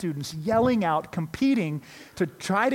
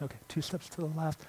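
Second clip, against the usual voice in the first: much softer, repeating it under the breath; muttered repeat; 9 dB quieter, faster than usual. Retold or imitated. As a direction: mumble the instruction back to yourself. Blindfolded kid repeating instructions.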